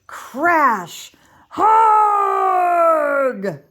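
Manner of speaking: loud whispered crash then a giant's yell; a giant tumbling over a rock; giant's crash and cry. Acted. Giant trips over the rock, loud pained cry. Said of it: "cried the giant".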